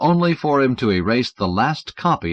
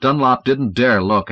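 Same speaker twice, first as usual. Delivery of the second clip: plain reading voice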